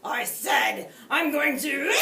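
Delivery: loud, slow furious shout; angry shout. A narrator's reading. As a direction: bellow it in frustration. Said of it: "The wolf sputtered".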